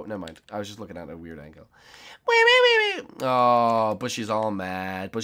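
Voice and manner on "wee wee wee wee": much higher sing-song wee; high giddy wee wee; playful squeal. Pretend. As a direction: squeal like on a ride. Playful noise while jumping.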